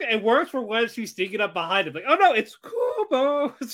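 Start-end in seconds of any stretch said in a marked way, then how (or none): 2.1-3.5 higher-pitched mock dread; mock alarm. As she sneaks up behind him, mock alarm. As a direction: play up a comic "oh no".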